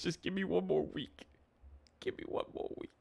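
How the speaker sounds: hushed, fading mock plea; mock pleading. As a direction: beg softly, trailing almost to a whisper. Mock begging viewer for another chance.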